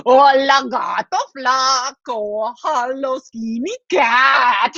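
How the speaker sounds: drawn-out sing-out call; brother mouse taunt. Acted. Brother mouse teases the sleeping cat through the fence, drawling taunt. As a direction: stretch each phrase into a slow taunt. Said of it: "He goes".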